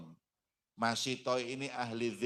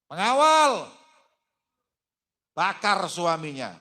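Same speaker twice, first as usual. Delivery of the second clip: much higher, much louder call to the guards; shouted order; 8 semitones higher, 14 dB louder than usual. Acted. Pharaoh calling his guards to burn the husband, shouting. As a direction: yell the command across the hall.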